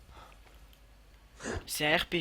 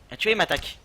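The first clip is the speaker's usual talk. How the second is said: excited; 5 semitones higher than usual. Real, not acted. Wolf attacks while playing.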